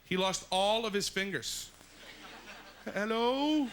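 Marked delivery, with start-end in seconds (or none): 2.9-3.7 high, loud call into the void. Acted. Guy with no fingers calling out, high and loud. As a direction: call out hello into the cold, echoing.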